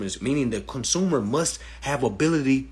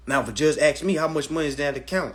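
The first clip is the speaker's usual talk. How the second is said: ordinary delivery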